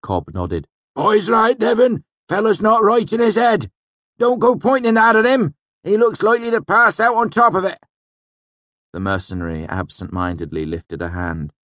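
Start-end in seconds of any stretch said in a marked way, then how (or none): 0.9-7.8 put-on high, loud rustic old-timer voice; old villager scolding a friend to back off; old village man. A narrator's reading. Old Cobb's character voice.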